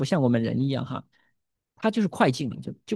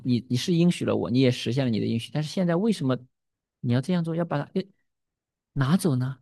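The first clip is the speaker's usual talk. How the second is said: usual speaking voice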